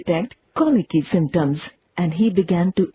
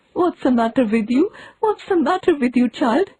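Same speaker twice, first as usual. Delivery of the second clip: worried aunt; 6 semitones higher than usual. A narrator's reading.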